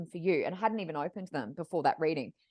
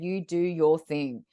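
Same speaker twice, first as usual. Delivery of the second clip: lower, very slow, soothing voice; serene divine woman; 4 semitones lower, 3 dB louder, slower than usual. Retold or imitated. Client supporting the medium in her work, serene and slow. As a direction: stretch each word out calmly and reassuringly. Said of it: "she talks like this".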